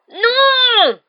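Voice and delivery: long, very high scream; cri d'horreur. A narrator's reading. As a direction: wail it loud and drawn out in panic. Horrified as Marge floats away. (hurlement aigu et prolongé)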